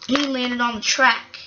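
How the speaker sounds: ordinary delivery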